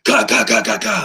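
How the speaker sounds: loud punchy ga sounds for drum hits; mouth drum hits. Retold or imitated. Describing how Neil sounded on his own kit, mouthed drum hits. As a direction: mimic the drummer's fill with your voice.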